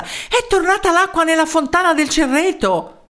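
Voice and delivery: high-pitched urgent announcement; winded excited news. A narrator's reading. Townsman running to tell the mayor, high excited voice. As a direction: burst out with the news after a run. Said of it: "con la voce affaticata dalla corsa".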